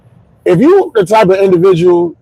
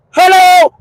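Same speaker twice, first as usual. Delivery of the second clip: squeaky, much higher hello, falling; mock confused hello; 12 semitones higher, 3 dB louder than usual. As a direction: play dumb with a high yelp. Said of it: "And I'm like".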